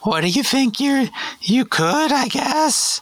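put-on high, polite teen cashier; chirpy drive-thru kid behind the counter; fast food cashier voice. McDonald's cashier roleplay.

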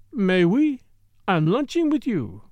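a hint of French, pouting at him; lightly French-accented Morel. Morel's voice held.

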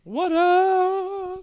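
very high, long-held shout; squeaky hype call. Pretend. Co-host hypes each beer announced, high squeal.

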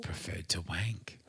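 unvoiced cheeky whisper; whispered aside. Real, not acted. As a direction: breathe it as a naughty aside.

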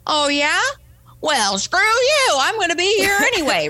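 high-pitched defiant retort; mock yelling back. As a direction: play a combative comeback, hammed up. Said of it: "expected me to yell back".

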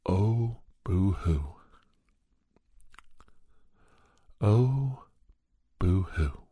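mocking boo-hoo sob; sarcastic mock crying; fake crying. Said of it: "the response from the person was".

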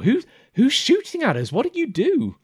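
high, loud burst of questions; alarmed accusation. Acted. Okus accusing his companion over the shooting, high and alarmed. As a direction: demand answers in a high, panicky pitch.

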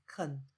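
"肯" said short and soft, unstressed; weak, unstressed "肯"; reduced schwa syllable. Shown as a demonstration. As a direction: let "肯" fall away with a neutral schwa vowel. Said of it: "所以C O N就会变成肯".

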